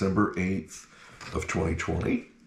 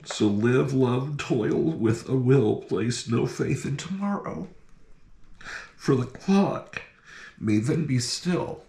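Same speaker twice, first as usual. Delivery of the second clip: poem reading; 4 semitones higher than usual. Acted. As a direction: read the poem like at a funeral. Reads memorial poem aloud.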